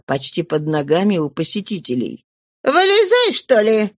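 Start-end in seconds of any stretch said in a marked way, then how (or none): 2.6-3.9 sharp yell, much higher and louder; harsh innkeeper shouting at the girl. A narrator's reading. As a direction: call the child out from under the table. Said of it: "крикнула Тенардье".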